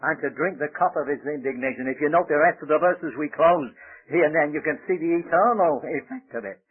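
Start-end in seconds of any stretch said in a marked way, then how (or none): none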